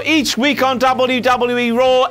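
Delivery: normal talking voice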